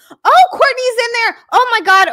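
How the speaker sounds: high, loud burst of delight; thrilled high exclamation; excited squeal. Real, not acted. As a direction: gush over a friend arriving. Thrilled a friend joined.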